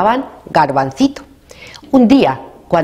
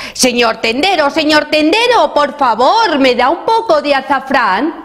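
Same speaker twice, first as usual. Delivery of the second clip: shouting request; 8 semitones higher than usual. A narrator's reading. Garbancito shouts to the shopkeeper who saw nobody, high mock shout. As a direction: call out high to be noticed. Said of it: "Garbancito ya gritó".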